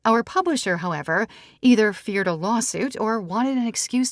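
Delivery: lightly voiced Sarah, frank. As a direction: own it plainly, no apology.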